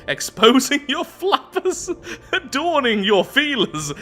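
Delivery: high, loud, laughing through the words; real laughing. Real, not acted. Player reading absurd sins aloud, cracking up. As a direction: crack up while trying to keep reading.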